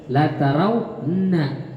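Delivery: La tarawna slowly with the n clipped short, showing it is hard to read; slow demo. Shown as a demonstration. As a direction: sound out La tarawna haltingly. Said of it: "Susah bacanya tuh".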